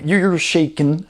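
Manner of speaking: ordinary talk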